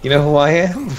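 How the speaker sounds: mock a player demanding to be recognised; cocky introduction. Teller imitates a player introducing himself, cocky.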